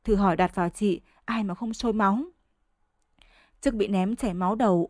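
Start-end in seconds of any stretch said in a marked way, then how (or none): none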